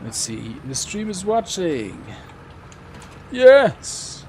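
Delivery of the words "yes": high, loud cheer; real burst of victory; triumphant yes. Real, not acted. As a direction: punch out a high-pitched celebration. Celebrates a hit in game.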